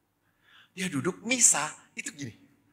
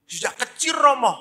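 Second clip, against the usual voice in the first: put-on high, loud child's answer; schoolchild; 12 semitones higher, 10 dB louder than usual. Boy answers priest about his polio, high child voice. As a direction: answer promptly like a keen pupil. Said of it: "Kamu sejak kapan polio?".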